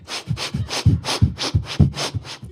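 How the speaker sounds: repeated quick nose sniffs; imitated sniffing. Mom catching a smell in the car, sniffing. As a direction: sniff around like a basset hound. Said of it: "My mom's nose starts twitching".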